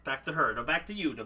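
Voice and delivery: normal talking voice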